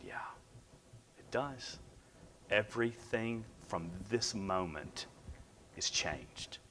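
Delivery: retold quiet realization, with weighted pauses; hushed, solemn. New dad answering the nurse, hushed and solemn.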